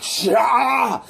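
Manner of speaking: high, drawn-out mock scream; imitated scream. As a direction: scream like a man being charged with power. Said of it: "he's screaming".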